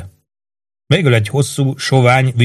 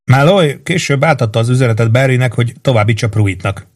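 plain reading voice

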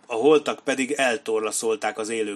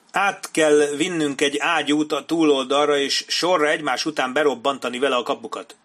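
lightly raised, loud, urgent officer; forceful proposal; 4 semitones higher, 6 dB louder than usual. A narrator's reading. Major proposes a cannon to breach the gates, forceful. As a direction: press the plan with energy. Major urging his plan loudly.

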